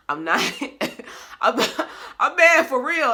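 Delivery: loud, high protest that cracks into a laugh; mad but laughing. Retold or imitated. Telling viewers how she yells at her laughing man, laughing protest. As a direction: insist you're mad while giggling at yourself. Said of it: "I be like".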